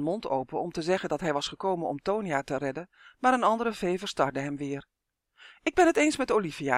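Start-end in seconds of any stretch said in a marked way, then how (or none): 5.6-6.8 high, loud and quick; calling out. A narrator's reading. Fairy siding with Olivia in the squabble, quick call. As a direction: chime in with a brisk, raised voice. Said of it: "riep ze".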